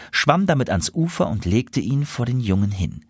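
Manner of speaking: ordinary delivery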